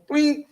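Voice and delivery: high short pling; bright sung pling; plucked pling. Shown as a demonstration. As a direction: imitate a plucked string. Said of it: "You go".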